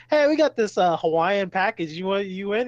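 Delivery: high, loud mock sales pitch; hammed-up telemarketer voice; imitated telemarketer. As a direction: pitch it brightly like a cold caller. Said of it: "then telemarketer calls him".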